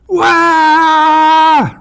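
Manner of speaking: sudden piercing high wail; doll wail. Retold or imitated. Narrator imitating the screaming doll, piercing high wail. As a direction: blast a shrieking electronic cry. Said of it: "wake me up with a".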